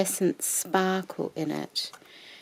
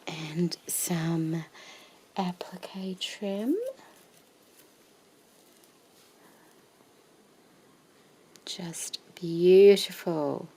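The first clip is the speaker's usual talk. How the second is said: whisper=40; slower than usual. Real, not acted. Whispering over trim.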